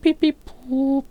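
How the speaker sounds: high squeaky beep-beep-boop; beeping Morse code sounds. Shown as a demonstration. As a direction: chirp it like a telegraph beeper.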